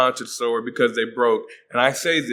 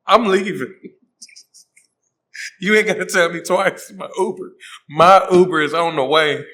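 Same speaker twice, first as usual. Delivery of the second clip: mock panic; 4 semitones higher, 4 dB louder than usual. Scared, fleeing the haunted house.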